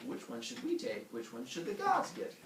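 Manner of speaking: high, soft puzzled questions; puzzled humans. Acted. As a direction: wonder aloud in a small high voice. Puzzled humans voiced in story.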